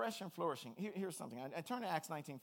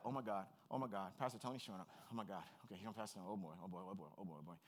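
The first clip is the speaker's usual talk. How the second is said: much lower and softer frightened muttering; panicked demon; 10 semitones lower, 10 dB quieter, faster than usual. Retold or imitated. Demon dreading Pastor Tony, low frightened mutter. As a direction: cower as Pastor Tony arrives. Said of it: "Or it's like".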